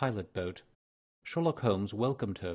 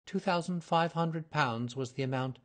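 put-on higher, earnest young woman's voice; nervous young lady; 6 semitones higher than usual. A narrator's reading. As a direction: voice her as an eager, flustered client. Mary Sutherland voiced by narrator.

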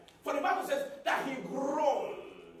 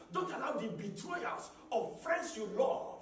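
ordinary talk

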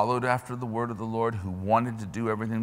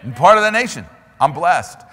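high, loud cry of belonging; shouted declaration; 6 semitones higher, 12 dB louder than usual. Acted. Preacher crying out to the congregation, high and loud. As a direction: shout it out with joy.

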